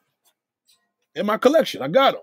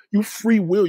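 normal talking voice

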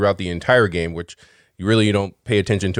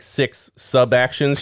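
ordinary delivery

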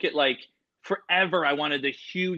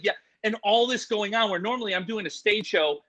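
ordinary delivery

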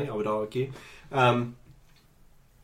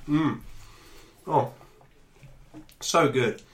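savouring with food in mouth; chewing while speaking; mouth full; slower than usual. Real, not acted. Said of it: "eating snack, savouring".

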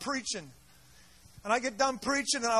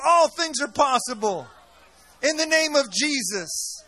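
shouting fiery sermon to the crowd; rally the congregation, loud and rousing; preaching at full force; 7 dB louder than usual. Real, not acted. Preacher rousing his congregation, fiery shout.

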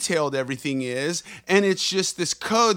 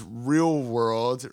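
air quotes voice; 6 semitones lower than usual. Shown as a demonstration. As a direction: drop the voice on "real world" as if making air quotes.

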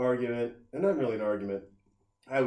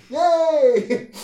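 high, loud, laughing fake cheer; mock cheer; 13 semitones higher, 9 dB louder than usual. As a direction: cheer it sarcastically through a laugh.

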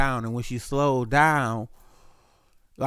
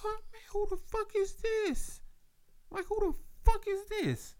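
much higher, baffled who-is-this; high-pitched disbelief; 6 dB quieter than usual. Retold or imitated. Texting a stranger, high stunned disbelief. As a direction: squeak out shocked confusion. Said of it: "And I'm like".

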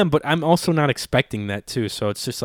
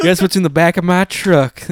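loud, higher mock-Southern boast; Southern gentleman; 6 semitones higher, 6 dB louder than usual. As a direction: crow it in a hammed-up Southern accent. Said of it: "I'm a Southern gentleman".

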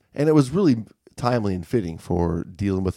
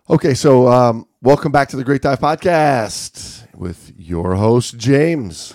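slow, louder podcast-host welcome; announcer intro; 4 semitones higher, 7 dB louder, slower than usual. Pretend. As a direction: open the show grandly, stretched out. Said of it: "work on our accents".

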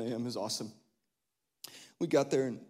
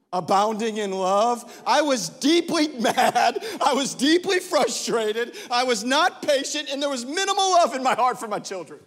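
forceful preaching; 9 semitones higher, 8 dB louder than usual. Acted. Pastor confessing he was mad at his kids, preached loud. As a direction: lean hard into each admission.

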